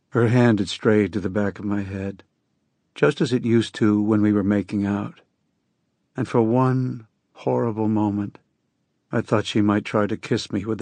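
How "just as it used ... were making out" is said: plain reading voice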